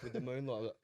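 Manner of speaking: usual speaking voice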